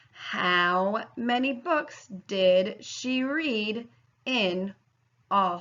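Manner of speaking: slow, deliberate word problem reading; slow read-aloud. Shown as a demonstration. Teacher slowly reading a word problem to students. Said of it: "I'm gonna highlight as I read".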